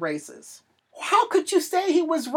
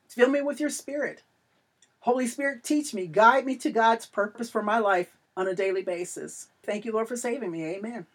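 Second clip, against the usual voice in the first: led prayer; 4 semitones lower, 5 dB quieter than usual. Acted. Leads listeners in a prayer.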